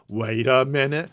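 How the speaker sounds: put-on echo at ordinary volume; mock dawning suspicion. Acted double take, softer echo.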